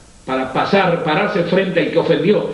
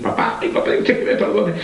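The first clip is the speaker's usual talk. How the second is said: lamento imitado; 7 semitones higher than usual. Retold or imitated. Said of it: "tirarse arriba de la tumba y decir".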